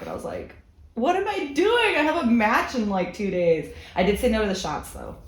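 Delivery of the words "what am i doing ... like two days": alarmed. Retold or imitated. Retold drunk self-reproach, high far.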